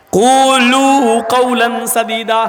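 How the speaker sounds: slow, measured chant of the verse; solemn recitation; Quranic recitation. Acted. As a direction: draw out each word like a reciter.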